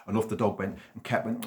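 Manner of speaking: plain reading voice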